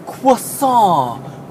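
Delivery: "croissant" stretched slowly, French-style with the final t dropped; flowing French croissant. Retold or imitated. Mocking the customer who learned European pronunciation, flowing croissant. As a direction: let "croissant" flow out, slow and affected. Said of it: "would just flow from their lips".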